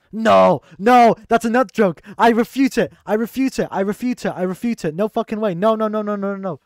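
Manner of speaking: loud, high, repeated protest; emphatic loud refusal. Real, not acted. Streamer spotting a joke set-up from chat, emphatic loud refusal. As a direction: shut the joke down, starting at full volume.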